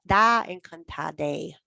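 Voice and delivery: "encantade" drawn out very slowly, ending in a clear e; teacher contrasting "encantade" slowly with "encantado". Shown as a demonstration. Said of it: "you're gonna say".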